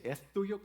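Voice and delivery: "¿Es tuyo?" con la S ceceada de niña, más aguda; ceceo infantil. Retold or imitated. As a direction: imitando la S torcida de la nieta Steffi. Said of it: "Como que le hace la S así". (Steffi pregunta al abuelo, ceceando la S)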